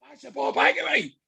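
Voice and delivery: pitched well up, half-yelled stadium call; retold shout. Fan on Thursday night shouting at Jota, mock-angry yell. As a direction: yell it at the winger like the manager did. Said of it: "I stood up at my seat and I shouted".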